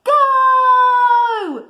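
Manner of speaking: loud high shout of go. A narrator's reading. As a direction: yell go to launch them. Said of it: "shouted Bramwell Brown".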